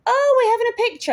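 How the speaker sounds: very high, quick excited squeal; drunk Scots girl. Retold or imitated. Drunk guest turning for a selfie, high and giddy. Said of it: "and just goes".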